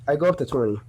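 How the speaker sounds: ordinary talk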